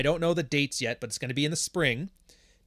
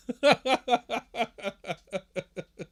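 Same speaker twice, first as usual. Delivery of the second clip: long high-pitched laughing fit; real laughter; 9 semitones higher than usual. Real, not acted. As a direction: burst out in a high, drawn-out laugh. Laughs at the French conjugations.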